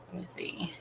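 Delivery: quick whispered aside to herself. Real, not acted. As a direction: breathe it out, barely voiced. Muttering to herself mid-thought.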